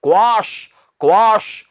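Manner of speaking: क्वाश with a long "aa" vowel; correct pronunciation. Shown as a demonstration. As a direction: say क्वाश as "kwaash". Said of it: "क्या कहना है?".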